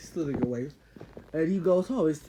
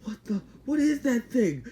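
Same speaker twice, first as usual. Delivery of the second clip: startled gasp; 8 semitones higher, 4 dB louder than usual. Retold or imitated. Startled characters see creature.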